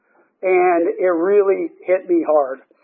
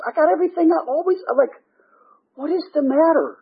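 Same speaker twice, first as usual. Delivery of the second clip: higher, exasperated puzzling at himself; baffled self-questioning; 6 semitones higher than usual. Retold or imitated. Man asking his mirror image what is wrong, baffled. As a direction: act out mounting bewilderment, pitch climbing.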